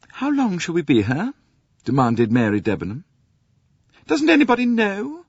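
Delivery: high, clipped impatient English voice; impatient Mary Debenham. Acted. Mary Debenham demands of the diners how long, impatient. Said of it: "Her voice sounded impatient".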